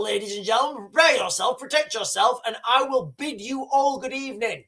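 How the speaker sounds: ordinary talk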